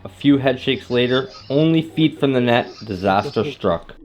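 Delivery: calm recorded voiceover; voiceover narration. A narrator's reading. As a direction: tell the fishing story evenly. Voiceover narration over music.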